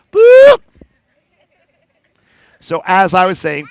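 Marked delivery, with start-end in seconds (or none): 0.1-0.6 high, loud spooky boo; high loud ghostly boo. Acted. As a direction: shout it to scare the bear. Ghost scaring.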